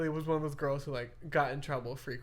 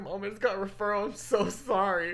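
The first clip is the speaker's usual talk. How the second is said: retold scared apology; 5 semitones higher, 5 dB louder than usual. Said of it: "I was so scared".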